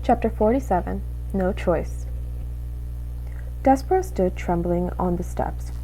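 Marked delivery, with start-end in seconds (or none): none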